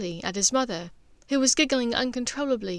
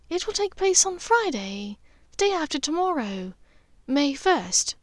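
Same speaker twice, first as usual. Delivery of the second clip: higher, firm and sure; decisive; 6 semitones higher than usual. Acted. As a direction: state the date with resolve. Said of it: "she said decisively".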